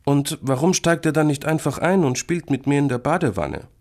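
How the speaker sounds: put-on high, wondering child voice; boy thinking aloud. A narrator's reading. Boy puzzles over the creature's behaviour, wondering. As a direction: wonder aloud like a small kid. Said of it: "denkt Florentin laut nach".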